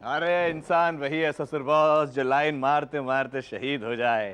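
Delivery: low, slow, grandiose delivery; mock-heroic declaiming.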